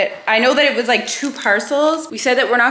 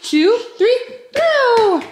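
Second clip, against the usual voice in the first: high, loud cheer on throw; playful countdown; 12 semitones higher, 4 dB louder, much slower than usual. Real, not acted.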